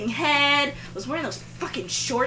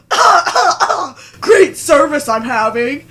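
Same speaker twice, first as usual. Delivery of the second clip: higher, louder, slow mocking drawl; sarcastic customer jab; 4 semitones higher, 12 dB louder, slower than usual. Rude customer taunting the waitress across the room, slow sarcastic jeer. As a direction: jeer across the restaurant to embarrass her. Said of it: "stupid jackass".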